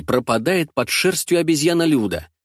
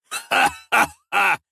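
slow, mocking horse laugh; guffawing thugs; 7 semitones higher, much slower than usual. Mustached thugs jeering at the girl, slow mocking guffaw. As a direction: bray it out slowly, jeering. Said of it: "ржут усачи".

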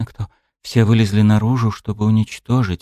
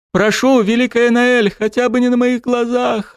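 sobbing scream. A narrator's reading. Dryad sobbing into the goddess's chest, pleading. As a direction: beg through sobs. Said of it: "рыдая ... продолжала кричать".

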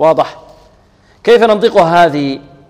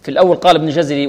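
ordinary talk

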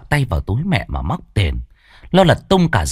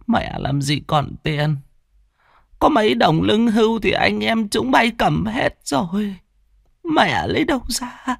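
put-on worried old mother; 12 semitones higher than usual. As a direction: plead helplessly in an old woman's high voice. Narrator voices old mother.

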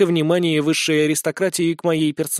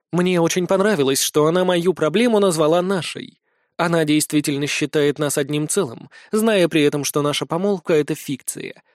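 ordinary delivery, nothing marked

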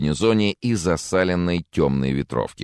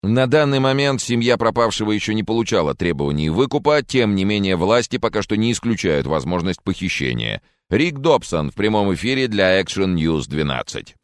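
formal live report sign-off; TV field reporter; 5 semitones higher, 3 dB louder than usual. Acted. Reporter signs off live to viewers, formal announcing voice. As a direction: wrap up the report in an on-air voice.

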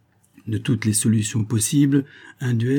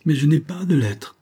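higher-pitched protesting exclamation; exclaimed protest; 6 semitones higher than usual. A narrator's reading. Lover objecting to the dictated letter, exclaimed protest. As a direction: burst out in protest, pitch lifted. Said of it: "s'écria-t-il".

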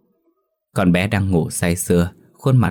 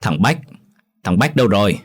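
calling out; 2 semitones higher than usual. A narrator's reading. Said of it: "cụ cất tiếng gọi".

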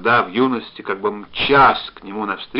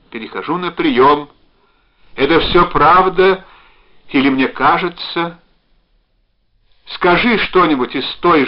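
loud, raised, deliberate voice; urgent slow hailing; emotional radio call; 5 semitones higher, 8 dB louder than usual. A narrator's reading. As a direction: call as if over a radio, pleading. Woman playing radio operator, emotional.

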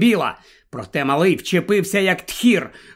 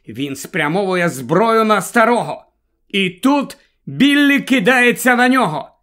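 ordinary delivery, nothing marked

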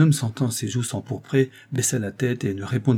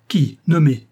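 loud, higher furious shout; furious demand; 5 semitones higher, 7 dB louder, slower than usual. A narrator's reading. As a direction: bark the demand for a name. Norbert furieux exige un nom. (exige un nom en criant, furieux)

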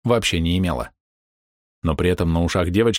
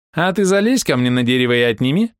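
laughing tease; 5 semitones higher, 4 dB louder than usual. Acted. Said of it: "разразилась смехом эта мелкая нахалка".

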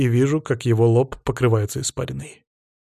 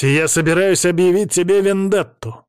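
higher, forceful announcement; defiant declaration; 7 semitones higher, 4 dB louder than usual. A narrator's reading. Young man declares vendetta before the council, defiant. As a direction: declare it as a threat.

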